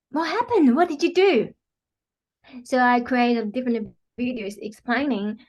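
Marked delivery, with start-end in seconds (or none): 0.1-1.5 imitating an astonished client, pitched up; full surprise. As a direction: act out amazed disbelief in a higher voice. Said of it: "look at me in full surprise".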